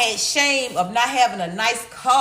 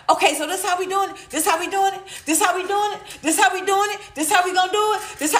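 higher, fast, nagging repeat; mocking cheater's partner; 7 semitones higher, faster than usual. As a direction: mimic a stuck, repeating girlfriend.

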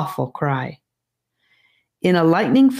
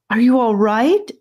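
worried Annika; 6 semitones higher, 4 dB louder than usual. A narrator's reading. Annika checks on Charlie.

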